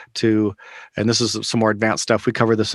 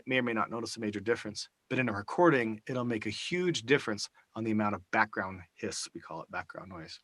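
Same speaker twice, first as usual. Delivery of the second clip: much softer, distant from the microphone; away from the mic; 10 dB quieter than usual. Shown as a demonstration. Trainer demonstrating mic distance to the class, off-mic and quieter. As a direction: speak from two feet off the mic. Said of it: "If I take this mic out here".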